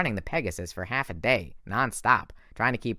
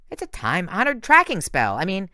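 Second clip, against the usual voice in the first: higher, a touch offended; offended satyr voice; 9 semitones higher, 5 dB louder than usual. Acted. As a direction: defend the spell, a bit hurt. Grover defending himself.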